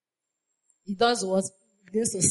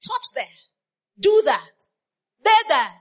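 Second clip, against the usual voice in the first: retold pastor's phone talk; 9 semitones higher, 6 dB louder than usual. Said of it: "will call on the phone and be saying".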